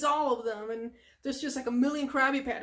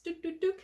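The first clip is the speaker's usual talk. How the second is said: high little doot noises; imitated scampering; 5 semitones higher, faster than usual. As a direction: make tiny running-off sounds. Imitates running to the bus.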